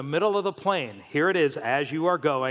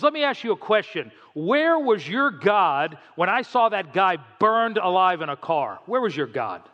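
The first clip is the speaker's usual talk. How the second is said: retold angry yell; 3 semitones higher, 3 dB louder than usual. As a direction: imitate a man demanding answers, voice raised. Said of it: "he yells out real loud".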